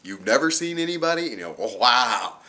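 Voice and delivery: high, loud sarcastic wow; imagined snarky comeback to doctor; mock disbelief. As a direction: needle him with fake amazement.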